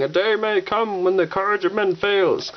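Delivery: Gandalf speech. Retold or imitated. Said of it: "Gandalf's here saying".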